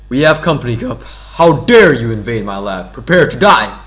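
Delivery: loud, dramatic game-villain threat; villain challenge. Acted. Villain scientist confronting lab intruders, loud challenge. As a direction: declaim it big, punching the key words.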